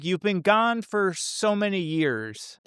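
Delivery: imitating Gordon Cole. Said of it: "Lynch says".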